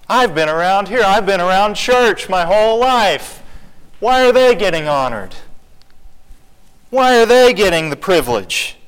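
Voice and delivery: loud, high, acted resentment; indignant protest. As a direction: protest loudly as an aggrieved churchgoer. Acts out resentful churchgoer protesting.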